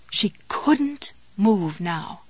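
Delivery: slow breathy whisper. A narrator's reading. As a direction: hush it, slow and tense. Tense narration, whispered.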